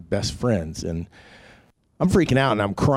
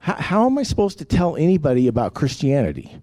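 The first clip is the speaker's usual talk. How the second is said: higher-pitched, exasperated mock yell; retold yelling at God; 6 semitones higher, 3 dB louder than usual. Angry man questions God at night, retold yell. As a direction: demand an answer from God, half-shouted. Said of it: "I'm yelling at the top of my lungs".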